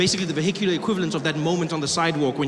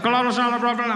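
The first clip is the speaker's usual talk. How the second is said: higher, garbled loudspeaker announcement; muffled, barely intelligible cop orders; irritated police loudspeaker; 4 semitones higher, faster than usual. Retold or imitated. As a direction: run the words together like a cop on a bad speaker. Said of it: "I don't know what the hell you want".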